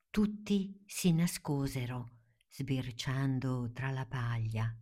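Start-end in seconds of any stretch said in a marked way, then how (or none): 2.5-4.8 softer, slow unvoiced whisper. A narrator's reading. Narrator hushing as the friends hide from bees, whispering. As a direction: breathe it out while hiding.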